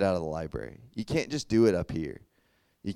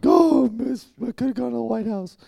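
much higher, louder whiny mock lament; mock regret; 7 dB louder than usual. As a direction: whine it like a sulky kid. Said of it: "not something that I'm like".

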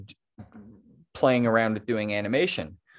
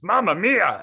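high, loud cartoon Italian "Mamma mia"; Italian character voice; 9 semitones higher, 5 dB louder than usual. Voicing his spaghetti plate character, high cartoon Italian voice. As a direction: ham it up like a spaghetti man. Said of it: "I'm doing it in a character voice".

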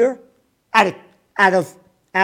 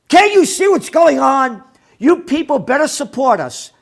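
normal talking voice

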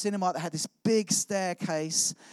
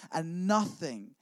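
ordinary delivery

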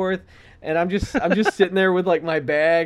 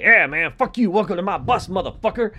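loud, raised mock trash talk; belligerent taunt; 4 semitones higher, 5 dB louder than usual. Old guy greeting the newcomer to his bus, belligerent. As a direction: imitate a cocky man picking a fight.

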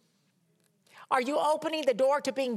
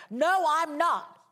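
No, I'm not, slow, higher and louder, NO hit hard; emphatic denial; 5 semitones higher, 4 dB louder, slower than usual. Real, not acted. Preacher rejects his own habit of saying sorry, slow and emphatic. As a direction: firmly overrule yourself.

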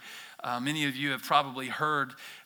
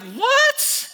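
high startled squeal; disbelieving cry; reacting to the angel's news; shocked what; 8 dB louder than usual. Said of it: "And I'm like".

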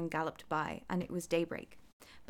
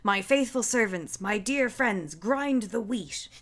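loud, higher, slow storybook shout; narrated shout; 3 semitones higher, 6 dB louder, slower than usual. A narrator's reading. Baba Yaga summons her faithful servants to grind wheat, shouting. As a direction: call out loudly, drawing out the words. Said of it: "Then she shouted".

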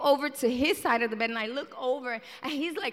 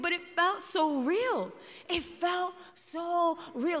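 higher, drawn-out mock protest; shaken husband, imitated; 5 semitones higher than usual. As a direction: imitate him insisting, stretching the words. Acted husband insisting after his nightmare.

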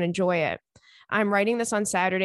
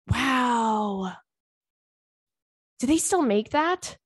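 surprised wow; 3 semitones higher, much slower than usual. Real, not acted. Host surprised the rice-a-roni brand still exists. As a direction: sound genuinely taken aback.

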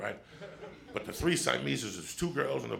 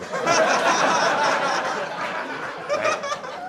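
long loud high cackle; shrieking laugh at his own joke; high cackling laugh; 8 dB louder than usual. Real, not acted. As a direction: burst into a high, helpless laugh.

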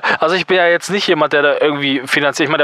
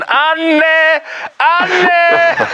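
high drawn-out call across the street. Retold or imitated. Imitating street kids calling a friend, high call. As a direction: mimic kids yelling a name outside.

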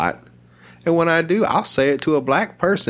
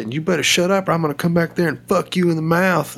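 fast, higher-pitched joking threat; playful tough talk, rushed; mock threat; 6 semitones higher, faster than usual. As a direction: rattle off an over-the-top threat in jest. Acting out joking threats to boss.

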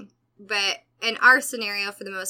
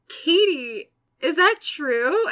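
higher, slow shocked question; shocked teacher; 4 semitones higher, 4 dB louder, slower than usual. Retold or imitated. Teacher asking the teller about the fight, shocked and slow. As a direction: sound like a stunned teacher. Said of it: "looks shocked and goes".

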